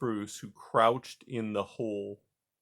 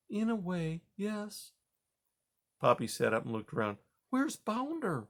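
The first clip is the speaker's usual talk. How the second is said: put-on higher, softer old mouse; calm grandma mouse; 7 semitones higher, 4 dB quieter than usual. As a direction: answer quietly. Grandma Poppy.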